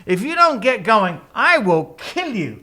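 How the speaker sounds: acted-out furious ultimatum; mock threat. Balaam repeats threat to donkey.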